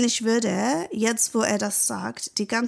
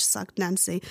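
ordinary talk, nothing marked

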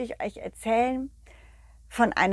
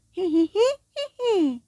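tiny high-pitched giggle; 10 semitones higher than usual. Acted. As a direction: giggle like a little ball. Ball's giggle voice. (hohes Kichern)

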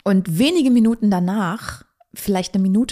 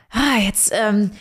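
overcome with emotion; 5 semitones higher, 4 dB louder than usual. Real, not acted. Daughter telling of her dying father, suddenly overcome. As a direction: voice rising and breaking as tears come. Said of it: "überkommt es mich doch ein bisschen".

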